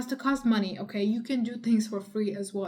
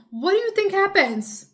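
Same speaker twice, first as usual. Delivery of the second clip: raised voice; 9 semitones higher, 8 dB louder than usual. Real, not acted. Escalating rhetorical rant at viewer.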